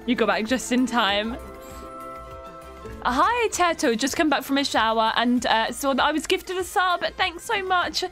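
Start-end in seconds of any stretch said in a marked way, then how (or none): none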